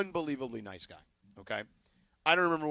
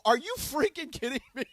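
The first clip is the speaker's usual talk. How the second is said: higher-pitched, retold disbelief; incredulous; 9 semitones higher, 3 dB louder than usual. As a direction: ask in disbelief, stressing "you". Retold disbelief at the two-week wait.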